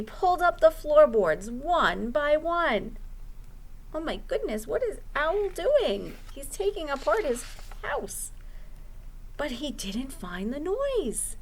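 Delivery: lively storytime narration; reading to children. Acted. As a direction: read it like a children's librarian at storytime. Librarian reads picture book aloud.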